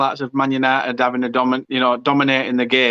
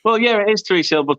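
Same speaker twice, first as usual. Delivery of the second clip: ordinary delivery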